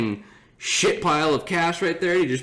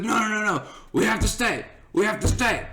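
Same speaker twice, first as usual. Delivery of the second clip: put-on higher-pitched, stubborn drunk buddy; imitated belligerent insistence; 8 semitones higher, faster than usual. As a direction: dig in, imitating a friend who refuses to leave. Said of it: "he's fucking belligerent at this point".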